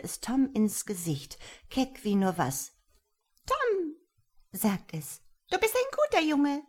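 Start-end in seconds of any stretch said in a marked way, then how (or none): 3.5-4.0 high piping voice. A narrator's reading. Tiny creature greeting Tom, high and piping. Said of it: "seine Stimme war sanft und hoch und piepsig". 5.5-6.6 quick, high, chirping creature; high piping voice. A narrator's reading. Tiny creature praising Tom, high and piping. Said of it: "seine Stimme war sanft und hoch und piepsig".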